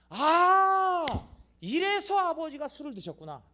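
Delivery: high, loud bitter realization; drunk son realizing. Retold or imitated. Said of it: "그렇게 동일시 되는 거예요".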